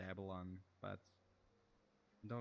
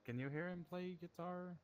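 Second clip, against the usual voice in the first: higher, carrying call to a friend; 8 semitones higher than usual. Real, not acted. Checking if a friend hears the guitar, calling out. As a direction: project the question across the room.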